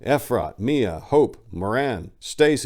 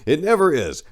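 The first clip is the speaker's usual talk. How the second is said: ordinary delivery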